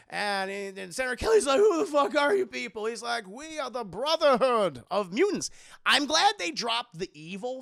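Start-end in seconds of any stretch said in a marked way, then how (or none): 1.6-2.8 loud, high mock outburst; alarmed demand. Captive senator questions the Brotherhood, loud mock alarm. 3.3-5.5 loud, hammed-up proclamation; grand villain announcement. Villain introduces his group to the senator, grandly proclaimed.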